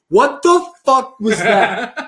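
slow, high-pitched mock shock; stunned disbelief. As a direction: act out a baffled friend, dragging the words. Acted stunned friend after tree catch.

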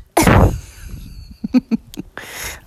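big loud burst of laughter. Real, not acted. Laughing at her own dirty joke, loud cackle.

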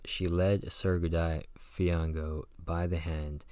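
ordinary delivery

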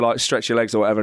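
usual speaking voice

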